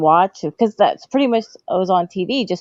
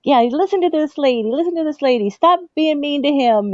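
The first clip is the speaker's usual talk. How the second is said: high, hammed-up referee egging on the crowd; mock referee; 9 semitones higher than usual. The referee urging the crowd on about grandmother, in a high, hammed-up voice. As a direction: play the ref urging on the fans.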